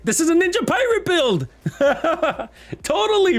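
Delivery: high, loud, laughing excitement; overly excited. Real, not acted. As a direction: squeal it out with real laughter. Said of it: "I'm overly excited about that".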